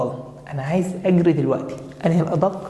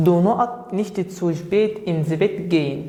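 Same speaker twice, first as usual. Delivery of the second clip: slow dictation; much slower than usual. Shown as a demonstration. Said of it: "reads the exercise sentence aloud".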